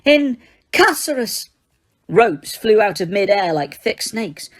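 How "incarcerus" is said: much higher, slow, shrieked incantation; screamed spell. A narrator's reading. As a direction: fling the spell in panic.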